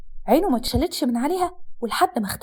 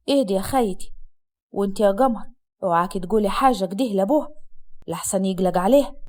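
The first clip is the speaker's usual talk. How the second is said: low-pitched Upper Egyptian man smoothing things over; calming brother-in-law; 5 semitones lower than usual. Acted. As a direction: pitch down to settle everyone. (أخو الزوج بيهدّي بصوت واطي)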